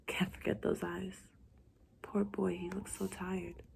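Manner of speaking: slow, breathy secret whisper; inner thoughts whispered. A narrator's reading. Narrator dreamily thinking about the tired boy, hushed whisper. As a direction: whisper it like a private confession.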